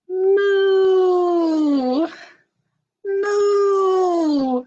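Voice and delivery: high, loud drawn-out moo; cow moo. Shown as a demonstration. Librarian leading kids in the cow sound, long loud moo. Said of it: "All I know is cows go".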